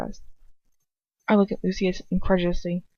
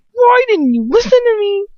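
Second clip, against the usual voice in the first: much higher, louder despairing plea; desperate cry; 11 dB louder than usual. Acted. Narrator crying out at Lucius, high and desperate.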